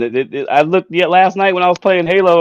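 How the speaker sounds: ordinary talk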